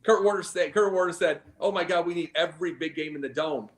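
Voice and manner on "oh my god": usual speaking voice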